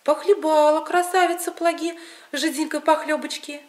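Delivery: ordinary delivery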